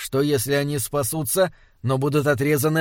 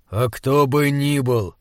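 plain reading voice

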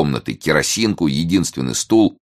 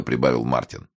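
ordinary delivery, nothing marked

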